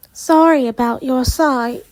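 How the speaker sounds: put-on light British accent. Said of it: "I don't know why she's British".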